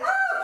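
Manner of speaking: very high, loud mock shriek; acted scream. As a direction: scream briefly as the startled wife. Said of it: "Myra screams again".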